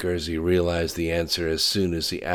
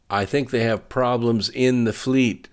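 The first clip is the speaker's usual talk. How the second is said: a bit too loud, higher pitched; overly loud; 7 semitones higher, 6 dB louder than usual. A narrator's reading. Mary reporting fleet problems over the phone, slightly over-loud. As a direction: speak up to be heard better. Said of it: "talking overly loud, like she was trying to be heard better".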